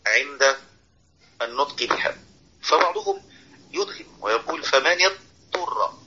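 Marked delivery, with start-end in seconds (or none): 4.6-5.9 slow, "اضطر" with the ض lost into the ط; wrong merged dad. Shown as a demonstration. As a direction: say "اضطر" as if only ط, the ض gone. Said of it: "أين الضاد؟ ذهب صوته". ("اضطر" بإدغام الضاد في الطاء خطأً)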